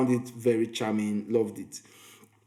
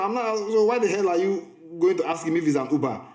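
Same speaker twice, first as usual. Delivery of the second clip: higher-pitched, mildly indignant retold question; exasperated challenge; 6 semitones higher than usual. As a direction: recount telling her off in disbelief.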